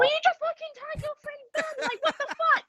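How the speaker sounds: high, fast screech at the ring; yelling at a wrestler who won't tag out; mock furious shout. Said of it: "shouting at Jack".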